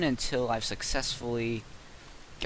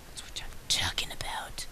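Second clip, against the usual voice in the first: 1 gleeful whisper; 10 dB quieter than usual. Real, not acted. Gloating win while gaming.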